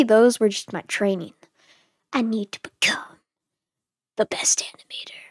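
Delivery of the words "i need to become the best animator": whispering. Acted. Secret ambition whispered aside.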